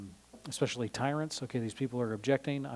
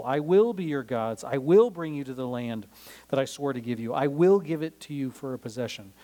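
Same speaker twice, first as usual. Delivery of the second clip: preaching God's promises; 5 dB louder than usual. Acted.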